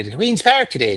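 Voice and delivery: high, lilting Nova Scotia accent. Shown as a demonstration. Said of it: "this sort of lilting thing".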